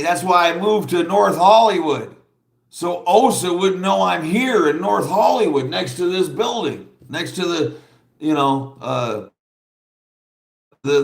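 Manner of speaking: mock his worried rambling; a hint of a jittery, secretive man; imitating him. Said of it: "And then he's like".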